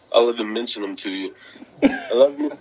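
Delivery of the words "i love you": calling to wife. Real, not acted.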